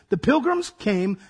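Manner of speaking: normal talking voice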